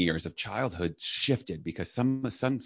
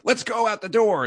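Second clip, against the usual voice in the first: much higher mock order; impatient command; 4 dB louder than usual. Leader hustling a child out the door, high mock command. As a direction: hurry them out like a boss.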